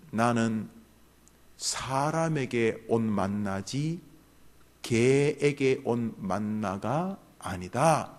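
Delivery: slow, weighty declaration; slow, solemn voice. Retold or imitated. Sermon voice of the rejecting word, slow and solemn. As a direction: pronounce each word slowly like a verdict. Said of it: "뚜렷하게 들려와요".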